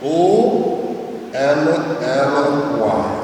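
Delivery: O N L Y, each letter slow and apart; spelled out slowly. Shown as a demonstration. Teacher spelling only for listeners, letters slow and separate.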